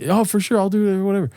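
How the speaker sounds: high mock brush-off; dismissive golfer. Mocking the golfer's promise, high and dismissive. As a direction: toss it off like a careless golfer.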